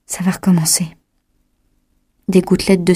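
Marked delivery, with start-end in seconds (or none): 0.1-0.9 a touch of strain; with effort. A narrator's reading. Chloé feels the pills starting again, mildly strained. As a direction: say it as if it costs some effort. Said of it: "dit-elle avec effort".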